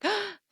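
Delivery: sharp high gasp of relief; gasp, pleasantly surprised; relieved gasp. Retold or imitated. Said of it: "and then you're like".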